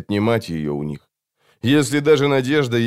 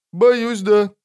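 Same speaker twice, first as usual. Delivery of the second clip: very high character voice; assassin's friend; 11 semitones higher, 3 dB louder than usual. A narrator's reading. Friend confirms the orders to the assassin, high-voiced. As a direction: answer high-pitched, a little firmer.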